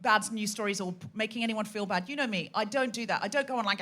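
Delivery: ordinary delivery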